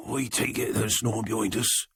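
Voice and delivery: rough, low-pitched rasp; hoarse gangster murmur. Acted. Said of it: "Kobe's low, rasping voice".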